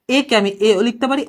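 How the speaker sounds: usual speaking voice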